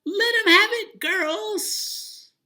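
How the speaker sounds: very high, loud battle cry; rally the girls to attack; angry shout. Acted. Angry Minnie rallying the washerwomen, shouting high. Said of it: "so angry that she shouted".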